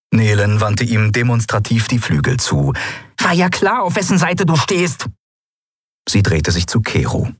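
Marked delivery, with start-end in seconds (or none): 3.2-5.1 high, bitter little fairy voice; piqued, high-pitched jab; offended accusation. Acted. Offended friend accuses him.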